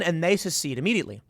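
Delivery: ordinary delivery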